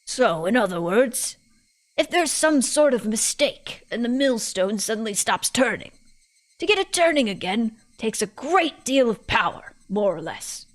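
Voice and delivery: put-on British old lady summing up; measured old woman with swooping emphasis; old person, British. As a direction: conclude like a wise British granny. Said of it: "all my old people voices turn British".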